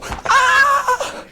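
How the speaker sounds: high startled shriek; hammed-up shocked cry; mock frozen gasp. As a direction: yelp like you're suddenly frozen. Frozen by the horse.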